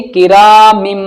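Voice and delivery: "किरा मिम" with a long hummed final m; n changed to m. Shown as a demonstration. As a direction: hold the closing m of "मिम". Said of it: "वो कैसे बदलेगी?". ("मिम" का आख़िरी मीम खींचकर)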